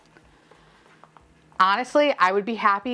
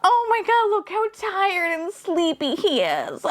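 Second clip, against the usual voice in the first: high, drawn-out squeal of adoration; cooing; 10 semitones higher than usual. Real, not acted. Gushing over a sleepy little toy, cooing. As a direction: coo slowly, far up in pitch.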